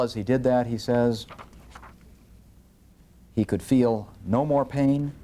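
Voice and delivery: ordinary talk, nothing marked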